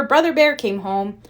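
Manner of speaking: usual speaking voice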